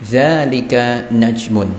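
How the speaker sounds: Dzalika najmun drawn out slowly; slow model reading. Shown as a demonstration. Teacher repeats the student's answer slowly and clearly. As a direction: read it slowly for the class to copy. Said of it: "Naam, dzalika najmun".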